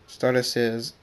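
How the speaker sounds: ordinary delivery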